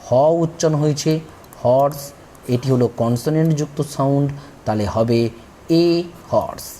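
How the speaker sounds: usual speaking voice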